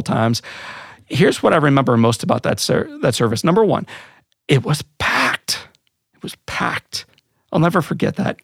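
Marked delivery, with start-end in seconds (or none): 4.5-5.7 breathy half-whisper, slow and amazed; hushed awe. Real, not acted. Awed hushed, unvoiced. 6.1-7.1 softer, fully whispered repeat; whispered echo. Real, not acted.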